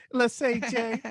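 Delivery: smooth flashy pimp swagger. Acted. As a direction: slick pimp calling. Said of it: "your fucking pimp voice".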